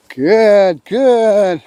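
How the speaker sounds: "Good, good" long and falling, evenly spaced; accented marker. Real, not acted. Trainer marking while the dog waits, drawn-out accented "Good, good". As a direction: stretch each "Good" out slowly. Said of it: "This is all about my pause".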